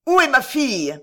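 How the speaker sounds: loud, high frantic shout. Acted. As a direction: bellow it, frantic for her. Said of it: "en criant".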